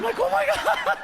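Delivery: high, laughing oh my God; shocked laugh. Retold or imitated. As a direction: gasp it out in disbelief, laughing.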